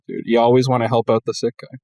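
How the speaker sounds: ordinary talk, nothing marked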